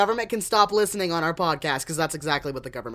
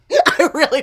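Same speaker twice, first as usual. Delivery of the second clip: laughing; 8 semitones higher, 7 dB louder than usual. Real, not acted. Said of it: "I goofed".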